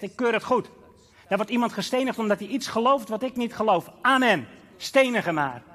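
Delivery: loud, high, cold approval of the stoning; bitter Paul voicing approval. Young Paul approving Stephen's stoning, loud and cold. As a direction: spit it out with hard zeal. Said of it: "als je zegt".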